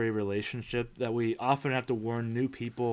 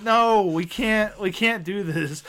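high, loud, drawn-out mock pleading; exasperated protest; 7 semitones higher, 5 dB louder, slower than usual. Dad telling his kids off, exasperated mock plea. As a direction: plead with the kids, half-despairing.

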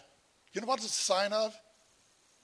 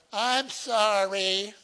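grudging sorry; 4 semitones higher, 5 dB louder, much slower than usual. Retold or imitated. Kid forced to apologize.

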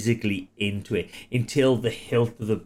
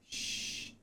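shushing hiss; 18 dB quieter than usual. Acted. Shushing the choking victim.

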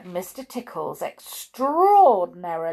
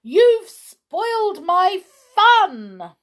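slow, very high, loud wail; indignant cry; 12 semitones higher, 10 dB louder, much slower than usual. A narrator's reading. Mister Tickle has lost his long arms, crying out indignantly. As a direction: protest like a thwarted child, drawn out. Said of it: "he cried".

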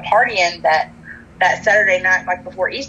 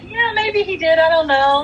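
coy and bashful, pitched much higher; 10 semitones higher than usual. Retold or imitated. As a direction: play it shy and giggly. Coy reply about his crush.

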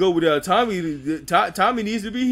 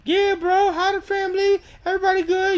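much higher, chummy greeting voice; mock friendly greeting; 12 semitones higher than usual. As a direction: greet him like an old friend. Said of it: "They was like".

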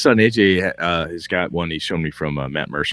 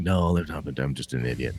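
sheepish admission; 6 semitones lower, 9 dB quieter than usual. Acted. Acted game master's sheepish admission.

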